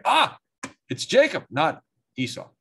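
high, loud "Ah" of discovery; the penny drops, out loud; sudden realisation. Acted. Blind Isaac catching the trick, surprised and loud.

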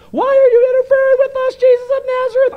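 very high, loud demon screech; strained high-pitched demon voice at full volume; evil spirit voice. Acted. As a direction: cry out as a shrieking, possessed spirit. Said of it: "why I made that voice".

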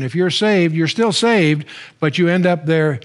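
normal talking voice

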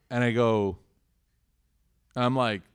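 ordinary talk, nothing marked

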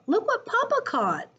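proud cubs. Acted. Cubs showing Mama the big fish, high excited squeal. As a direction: show off Papa's catch excitedly. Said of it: "said the cubs".

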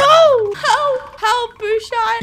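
high-pitched wailing plea; panicked cry for help. Pretend. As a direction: wail for a friend to rescue you. Chased, calling for help.

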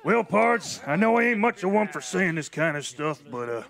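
lightly voiced drawling cowboy; gruff frontier captain, awkwardly sentimental. Bodega toasting the crew, in a lightly voiced cowboy manner.

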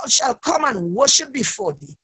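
ordinary delivery

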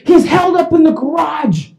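high shouted alert; urgent warning. Retold or imitated. Cop warning the homeowner about the garage, urgent shout. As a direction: shout it as an emergency.

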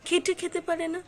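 imitating a scornful passer-by. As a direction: sneer it like a passer-by judging a beggar. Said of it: "তাদের অনেকের কথাবার্তা".

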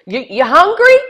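high, loud, eager mother; hammed-up hospitable mom; loud Italian mom. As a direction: bellow the offer like a pushy mom. Mom offering food.

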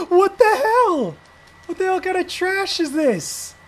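high, slow outraged yell. Real, not acted. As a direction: drag it out in disbelief. Game freezes mid fight.

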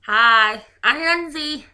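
talking around a mouthguard; muffled words past a mouthguard; wearing a mouthguard. Real, not acted. Intro redone wearing mouthguard.

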